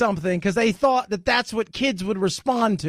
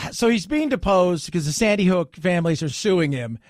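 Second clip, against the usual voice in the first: normal talking voice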